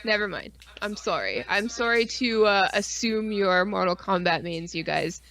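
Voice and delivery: normal talking voice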